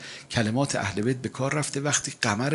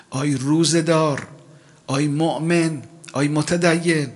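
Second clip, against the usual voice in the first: raised, ringing appeal; higher, louder preacher's cry; calling out; 4 semitones higher, 5 dB louder than usual. Acted. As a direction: call out to the faithful. Preacher calls out to believers.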